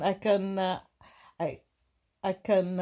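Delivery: ordinary delivery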